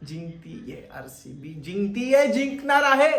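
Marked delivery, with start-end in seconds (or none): 1.5-3.1 rising to a loud, high finish; excited insistence. Real, not acted. Fan willing RCB to win, building excitement. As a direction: build from usual voice into a cheer.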